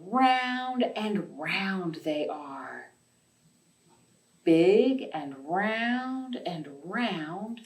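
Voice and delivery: slow, gentle storybook reading; reading to children. Acted. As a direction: read it like bedtime storytime for little kids. Reads picture book to children.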